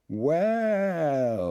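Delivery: high, loud, drawn-out well; wondering welcome. Acted. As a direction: greet him with wonder. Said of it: "with all the emphasis of wonder and welcome".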